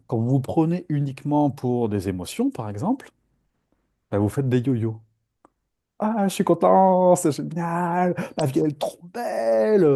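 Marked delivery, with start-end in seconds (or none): 6.0-9.8 high, drawn-out gushing of bliss; over-the-top happy squeal of joy; mock elation. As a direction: gush as if on top of the world. Acting out emotional high.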